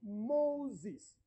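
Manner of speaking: "Moses" with a long first syllable, Mo-uses; correct pronunciation. Shown as a demonstration. Preacher showing the name's roots, "Moses" carefully said as Mo-uses. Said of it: "the correct pronunciation should".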